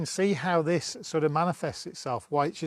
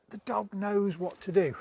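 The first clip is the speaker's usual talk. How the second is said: mock frustration; 5 semitones higher than usual. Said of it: "we might then get a little bit frustrated".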